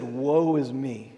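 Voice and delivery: slow, low woe; woeful lament. Acted. Isaiah before God in the throne room, slow lament. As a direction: let it sink, slow and subdued. Voicing Isaiah's dread in God's presence.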